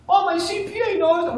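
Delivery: high-pitched imitation of an objecting client; mock objection. Client pushing back on the trainer's advice, mock objection.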